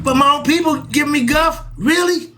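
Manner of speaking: high, disbelieving outrage; can't believe it, voice shooting up; incredulous. Real, not acted. Indignant at his own people.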